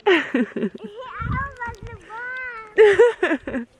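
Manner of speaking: squeaky high hello to a kitty; high-pitched pet talk; high cooing hello. Real, not acted. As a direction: sing it up high like greeting a pet. Cooing at a cat.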